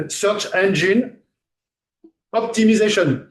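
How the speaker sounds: "Search Engine Optimization" slow, word by word; "Engine" ending in "een", deliberately un-American; no American accent. Shown as a demonstration. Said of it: "qui veulent faire un accent américain et qui font autrement". (« Search Engine Optimization » lentement, sans accent américain)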